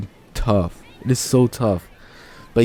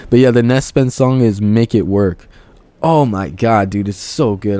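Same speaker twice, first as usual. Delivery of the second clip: ordinary talk, nothing marked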